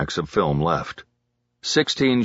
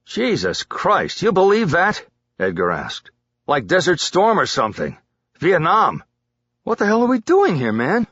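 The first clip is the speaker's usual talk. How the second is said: hyper, shaken; 9 semitones higher than usual. A narrator's reading. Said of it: "He talked a lot when he was hyper".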